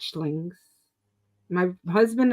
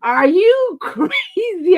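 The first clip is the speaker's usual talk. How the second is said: slow, high, loud disbelief; doctor asks if she's crazy, laughing; incredulous doctor; 8 semitones higher, 5 dB louder, much slower than usual. Retold or imitated. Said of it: "and he goes".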